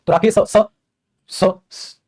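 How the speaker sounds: "स, स" stretched as a hissing s sound after "Rakesh"; wrong hissing s. Shown as a demonstration. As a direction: hiss the extra "स" after "Rakesh" to show the mistake. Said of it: "गलत, तो ये गलत हो जाएगा".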